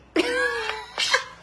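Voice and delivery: sudden high-pitched laugh; real laugh; burst of high laughter. Real, not acted. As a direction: crack up at herself. Laughing at her own problem.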